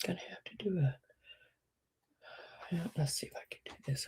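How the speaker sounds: rapid whispered muttering; hurried hushed mutter; fast whisper. Real, not acted. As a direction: talk yourself through it under your breath. Muttering while fixing computer.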